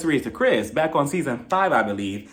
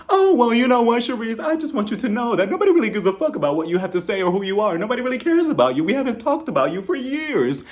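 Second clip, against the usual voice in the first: mocking Karen; 6 semitones higher than usual. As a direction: pitch it up and sneer like Karen.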